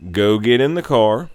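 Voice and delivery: ordinary talk, nothing marked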